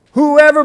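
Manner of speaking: "Whoever" hit hard at the start; stress on WHOEVER. Real, not acted. Preacher underlining the word for the congregation, emphatic. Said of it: "Underline the word whoever".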